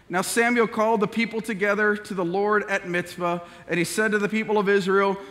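loud, proclaiming Bible reading; scripture reading. Acted. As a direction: declare the words of the Lord. Reads scripture aloud.

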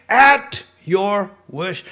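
high, loud, drawn-out "Nevertheless"; emphatic giving in. Acted. Peter answering Jesus, loud and high. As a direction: give in with a big sigh of emphasis.

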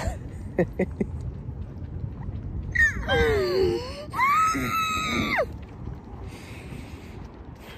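high laughing scream; a shrieking cry of disbelief. Real, not acted. Reacting to the driver's photo, shrieking laugh.

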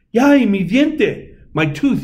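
high, slow cry of joy over his tooth; delighted cheer. A narrator's reading. Little boy cheering over his lost tooth, delighted. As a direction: cheer it out, thrilled. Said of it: "he said".

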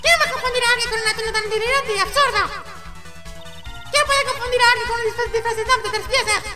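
very high, squeaky put-on voice; indignant character. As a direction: squeal in disbelief at the absurdity. Personaje indignado, voz aguda. (indignación incrédula con voz muy aguda)

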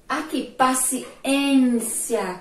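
paciência slow, leaning on ên; stressed reading. Shown as a demonstration. Teacher to pupils, modelling the strong syllable. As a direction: stretch paciência so ên stands out. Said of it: "Quem é a mais forte?".

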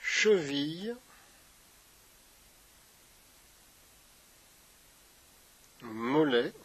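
unhurried meditation guide with long pauses; guided meditation. Acted. Yoga teacher guiding listeners through a body scan, guided meditation.

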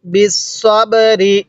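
বিসসাবরি slowly, with the সৎ sound clearly articulated; careful recitation. Shown as a demonstration. Teacher demonstrating the correct সৎ sound, slow careful recitation. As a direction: model বিসসাবরি slowly for students. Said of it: "এটা সৎ".

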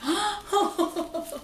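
high gasp breaking into a laugh; delighted gasping laugh. Real, not acted. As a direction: gasp with surprise, then laugh. Opens the next gift.

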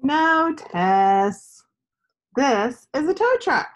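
a hint of a kind friend correcting her; patient boy answering. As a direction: friend Gus gently correcting Tess, lightly voiced. Gus answers Tess in story.

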